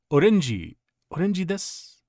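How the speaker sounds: "orange" said the Japanese way, as o-ren-ji; "orange" in three syllables, o-ren-ji; Japanese pronunciation. Retold or imitated. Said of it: "orange was orange".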